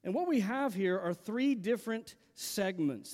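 usual speaking voice

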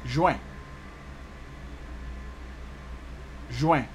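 juin said as zhweh with a nasal vowel, no final N; Creole pronunciation. Shown as a demonstration. Teacher giving learners June in Creole, juin as nasal zhweh. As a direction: model juin twice, pause between.

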